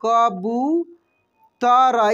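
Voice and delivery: कबूतर stretched very slowly, each syllable held; drawn-out spelling-out. Shown as a demonstration. Teacher to pupils, drawing the word out slowly. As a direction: sound out कबूतर for the class. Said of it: "क्या?".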